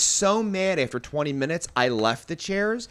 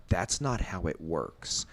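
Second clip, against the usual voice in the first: low, slow, soft rebuttal; restrained anger; 9 semitones lower, 8 dB quieter, slower than usual. Retold or imitated. Answering the man pointing at the sign, quietly restrained. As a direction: keep it slow and tightly contained. Acting out the chair argument.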